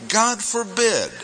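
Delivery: high, slow, emphatic refusal. Retold or imitated. Teacher voicing Paul's answer, high emphatic no. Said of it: "Emphatic no, that's Paul's answer".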